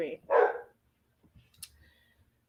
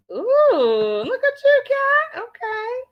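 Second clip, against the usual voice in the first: playful praise; 12 semitones higher, 4 dB louder, slower than usual. Real, not acted. Praising a chat member.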